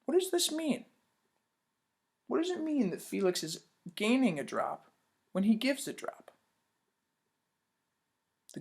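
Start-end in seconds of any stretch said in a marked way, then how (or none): none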